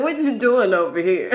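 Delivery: a mock British accent, laughing through it. Queen Sheba teasing her friend in a lightly put-on British accent. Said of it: "Queen Sheba with a British accent".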